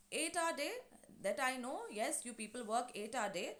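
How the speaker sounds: ordinary talk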